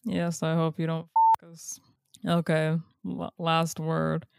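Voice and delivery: usual speaking voice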